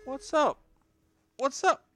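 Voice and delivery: squeaky high cartoon greeting; silly squeaky hello. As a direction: pitch way up and squeal it. High mock greeting to pet/baby.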